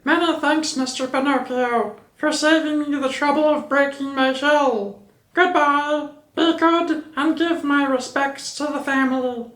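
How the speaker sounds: very high, dainty little chick voice; high, polite little chicken; tiny polite chick. A narrator's reading. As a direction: chirp it out with a tiny bow. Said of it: "very little and polite".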